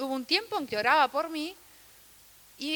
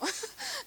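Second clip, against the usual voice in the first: soft laugh; 13 semitones higher, 9 dB quieter than usual. Real, not acted. Laughs after her own confession.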